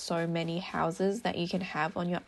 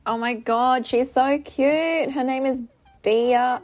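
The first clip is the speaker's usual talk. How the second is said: loud, higher, thrilled voice; excited gushing; 5 semitones higher, 9 dB louder, slower than usual. Real, not acted. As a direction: gush loudly over something adorable. Gushing over new villager.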